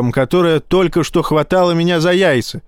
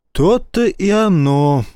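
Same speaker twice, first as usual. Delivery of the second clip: sighing; 5 semitones higher, slower than usual. A narrator's reading. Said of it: "вздохнул старпом".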